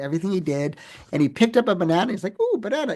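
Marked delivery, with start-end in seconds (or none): 2.4-3.0 high delighted ooh, lightly imitated; a mock-thrilled coo at the fruit; little excited. CEO spotting a banana at a meeting, mock-delighted high coo. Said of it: "he seemed a little excited".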